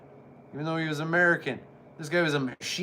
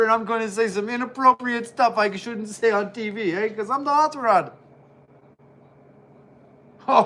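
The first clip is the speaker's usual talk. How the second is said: higher, louder brash boasting wrestler voice; Roddy Piper impression; 4 semitones higher, 3 dB louder than usual. Vlogger does a bad Roddy Piper brag, brash boasting. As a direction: brag like a cocky wrestler on the mic. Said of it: "bad impression of Roddy Piper".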